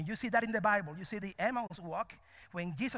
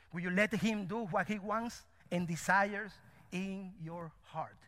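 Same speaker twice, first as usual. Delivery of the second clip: ordinary talk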